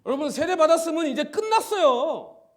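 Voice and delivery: high, loud climactic proclamation; fervent preaching. Acted. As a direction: proclaim it to the whole church. (세례를 선포하는 뜨거운 외침)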